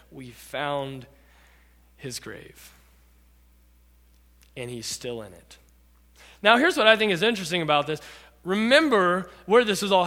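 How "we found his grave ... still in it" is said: low, soft, deliberate pauses; hushed announcement. Acted. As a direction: let each phrase fall quietly into silence. Archaeologist's grave claim, solemn.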